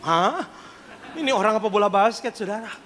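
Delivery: usual speaking voice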